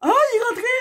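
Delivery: high delighted squeal; excited cry. Real, not acted. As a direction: squeal with relief as it clicks in. Earring finally goes in.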